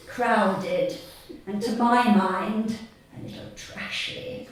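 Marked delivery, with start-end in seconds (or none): 3.2-4.5 snooty aside. Acted. Snobbish aside about theme park.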